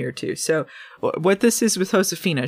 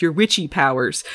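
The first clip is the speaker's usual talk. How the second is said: normal talking voice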